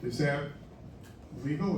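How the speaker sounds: usual speaking voice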